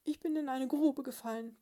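high, loud mouse squeak; piepsender Haselmausbräutigam. A narrator's reading. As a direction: squeak up out of the pit. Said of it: "piepste es gedämpft herauf". (hohe, piepsende Mäusestimme)

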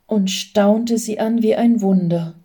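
ordinary delivery, nothing marked

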